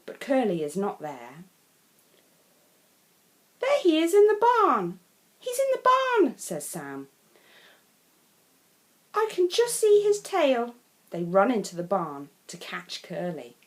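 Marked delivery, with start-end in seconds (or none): 3.6-6.4 high, loud excited cry; excited spotting. Acted. Sam excitedly telling everyone he has spotted Curly, high and loud. Child spots tail in barn. 9.1-10.7 high, louder eager voice; eager child. Acted. Sam eagerly telling the others he can see the tail.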